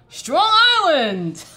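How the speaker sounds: high, loud, drawn-out cheer; playful hometown holler. Real, not acted. Streamer calling out the hometown nickname, playful holler. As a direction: holler it long and proud, laughter close behind.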